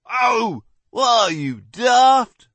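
loud outraged yelp; pained shout. Acted. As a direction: cry out in pain and outrage. Man's beard yanked, cries out.

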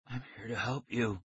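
very soft, whispery man's voice; thin whisper. A narrator's reading. Stranger reassuring captured kids, thin whisper. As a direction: speak in a thin, hushed voice. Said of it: "He had a thin whisper of a voice".

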